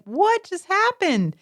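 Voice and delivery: high, slow, drawn-out bafflement; bewildered child. Retold or imitated. Little kids reacting to their two-faced mother, baffled. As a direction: act out a kid's astonished question.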